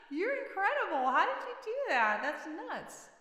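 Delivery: high, loud, laughing amazement; amazed laughing. Real, not acted. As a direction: burst out in delighted disbelief. Amazed by a chat trick.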